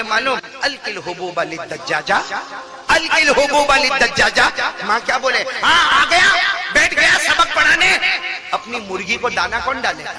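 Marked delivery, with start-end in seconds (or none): 2.9-4.7 high, loud, slow command; drawn-out, raised-voice instruction; loud acted order. Mother's order repeated in full voice. 5.6-8.0 high, loud telling-off; full-voiced mock rebuke; acted scolding. Mother scolding her teacher son.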